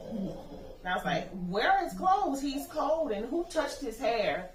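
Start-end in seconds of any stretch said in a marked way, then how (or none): 1.5-4.5 high, loud, frantic questioning; distraught demand. Retold or imitated. Mother demanding answers over her son's body, distraught and loud. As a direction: demand answers in raw grief.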